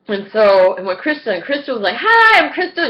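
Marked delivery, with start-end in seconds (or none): 2.0-2.9 high, loud, perky greeting; peppy. Retold or imitated. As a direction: mimic a peppy self-introduction. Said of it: "she was peppy and shit".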